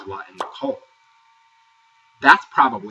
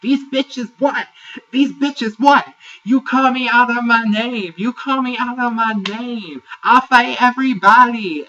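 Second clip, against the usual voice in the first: mock angry Jada; 12 semitones higher, 8 dB louder than usual. Recapper acting out Jada's loud outburst at Judy. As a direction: ham up a furious confrontation. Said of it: "here comes Jada slithering".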